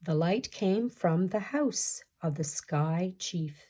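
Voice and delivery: usual speaking voice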